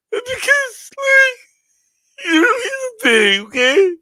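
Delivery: high, sobbing mock yell; mock crying. Streamer playing a crying boxing fan, high sobbing wail. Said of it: "cry this out".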